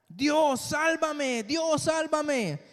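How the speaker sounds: high, loud cry for rescue; desperate cry. Acted. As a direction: call out to God, desperate. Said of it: "empezó a gritar". (grito desesperado y agudo)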